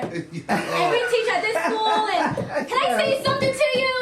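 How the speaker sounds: imitating a tipsy teacher, high and laughing; giggly teacher confession. Retelling a teacher's bar confession.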